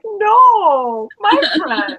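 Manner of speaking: high, loud mock wail; freaked-out dog. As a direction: wail in pretend despair as the dog. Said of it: "she totally freaked out".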